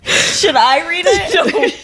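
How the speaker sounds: laughing through the question. Real, not acted. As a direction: giggle it out slowly. Laughing at childhood letter.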